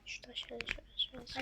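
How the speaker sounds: whispering. Real, not acted. Guiding quietly during play.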